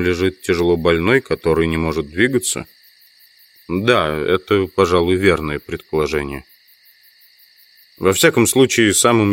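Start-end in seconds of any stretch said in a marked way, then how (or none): none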